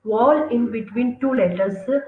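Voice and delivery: English definition read word by word; slow dictation. Shown as a demonstration. Teacher dictating the definition of संयोग for notes, very slowly. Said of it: "repeats the definition".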